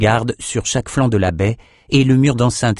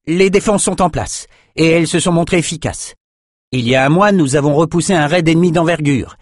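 put-on young officer reporting; 5 semitones higher than usual. A narrator's reading. Brother reciting his report at attention.

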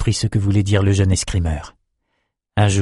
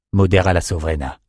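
plain reading voice

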